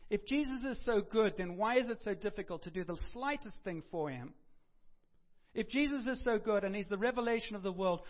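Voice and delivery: loud, urgent sermon build; preaching. Real, not acted. Pastor challenging the church's commitment, loud urgent preaching. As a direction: press the challenge home to the church.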